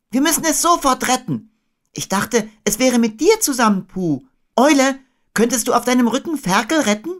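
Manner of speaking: put-on high, urgent boy; Christopher Robin. A narrator's reading. Boy planning Piglet's rescue, high and urgent.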